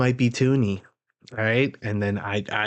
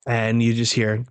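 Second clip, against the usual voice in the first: usual speaking voice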